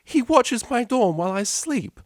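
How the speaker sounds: barely holding back a scream; outraged. Acted. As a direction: Octavia appalled at being watched. Resists urge to scream.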